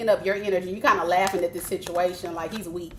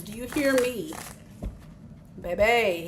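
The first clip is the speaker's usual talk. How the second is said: ordinary talk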